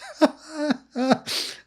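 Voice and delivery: helpless high-pitched giggle; high helpless laugh. Real, not acted. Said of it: "I can't stop laughing right now".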